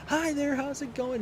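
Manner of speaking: high, sweet Southern cashier greeting; imitating a friendly Southern cashier; cute southern drawl. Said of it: "the cute little southern drawl".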